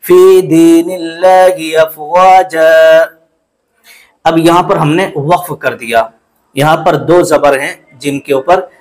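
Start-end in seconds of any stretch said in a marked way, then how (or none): 0.0-3.0 फी with the ya drawn out long, chanted slowly. Shown as a demonstration. Teacher shows stretching the ya, slow chant. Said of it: "या को आपको खींचना है".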